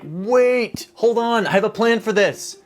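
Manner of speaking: high-pitched, hammed-up "wait, hold on"; urgent plea. Engineer stopping the US from backing out, mock-urgent. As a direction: jump in high to rescue the deal. Acted plea to save deal.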